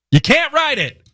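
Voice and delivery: "You can't write it" thrown up high in disbelief; incredulous high repeat. Real, not acted. Host to co-host about the plague news, incredulous high repeat. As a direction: toss it up high, amazed at the absurd story.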